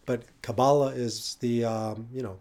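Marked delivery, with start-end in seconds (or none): 0.4-0.9 Kabbalah stressed in the middle, ka-BAH-la; Anglo-American pronunciation. Shown as a demonstration. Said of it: "sort of Anglo-American pronunciation".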